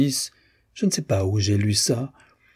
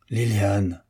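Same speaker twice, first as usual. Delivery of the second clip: stern reproach. A narrator's reading. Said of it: "un peu étouffée, se faisait sévère".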